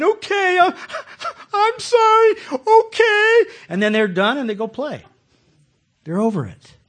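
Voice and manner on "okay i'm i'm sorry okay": put-on high, loud blubbering kid apology; a mock-crying child saying sorry. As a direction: whimper it like a sorry little boy.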